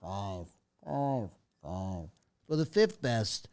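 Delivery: ESPN countdown voice. Acted. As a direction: boom it out like an old sports countdown. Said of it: "the old E S P N countdown voice".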